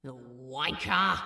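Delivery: low, loud scornful curse; disgusted thought. A narrator's reading. Crowley cursing Gabriel in his head, low and scornful. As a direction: spit the insult. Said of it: "Crowley thought".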